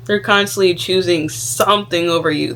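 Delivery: ordinary delivery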